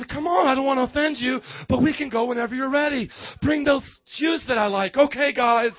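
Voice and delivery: normal talking voice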